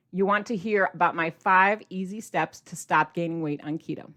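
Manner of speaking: ordinary talk, nothing marked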